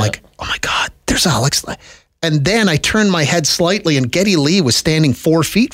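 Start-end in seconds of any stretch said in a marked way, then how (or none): 0.4-1.8 awed whisper. Retold or imitated. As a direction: whisper it like seeing a star. Retelling spotting a celebrity.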